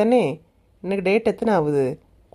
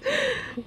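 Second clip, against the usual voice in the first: mocking laugh; 9 dB quieter than usual. Girl laughs at angry woman.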